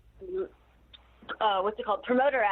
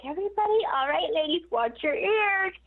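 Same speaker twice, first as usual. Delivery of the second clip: teasing warning; 7 semitones higher than usual. Retold or imitated. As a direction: sing out a playful warning to the room. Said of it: "I was like".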